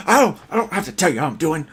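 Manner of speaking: loud, fast mock outburst; Johnson freaking out. Candidate answering a friendly hello, mock freak-out. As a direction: snap back, high and loud, as if losing it. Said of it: "he's, like, freaking out".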